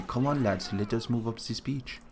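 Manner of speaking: a light mock German accent, softly spoken. German officer rallying his lads, light mock German accent. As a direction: soldier urging in a hint of a German accent. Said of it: "speak more of a German accent".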